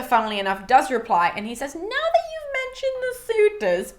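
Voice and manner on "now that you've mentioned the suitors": very high, slow sing-song Nestor act; nosy old storyteller. Retold or imitated. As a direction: stretch it out, slyly feigning innocence. Acts Nestor's nosy reply.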